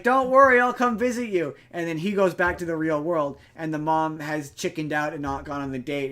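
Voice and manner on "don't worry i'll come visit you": high, loud parting promise; retold breezy reassurance. Retelling the brother leaving his sister, breezy reassurance. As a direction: call out a mock-cheery goodbye.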